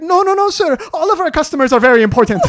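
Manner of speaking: high, loud, frantic mock appeasement; hammed-up pleading, breaking into laughter; placating relationship manager. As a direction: imitate a flustered manager cutting in. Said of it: "try to separate us. She's like".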